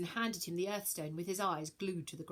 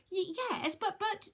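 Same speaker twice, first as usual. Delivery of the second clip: high nervous stammer; 13 semitones higher than usual. Acted. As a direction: stumble over the words. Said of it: "stammered Virgo".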